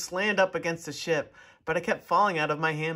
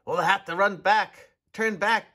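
louder call over the storm; 4 semitones higher, 4 dB louder than usual. A narrator's reading. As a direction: call out to the crew. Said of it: "called another".

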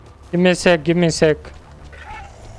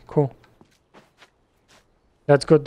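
ordinary talk

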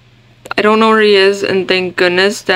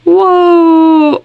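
loud, higher startled whoa; surprised whoa; 7 semitones higher, 5 dB louder than usual. Real, not acted. Player shouts whoa as the cart drops. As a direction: caught off guard by the drop.

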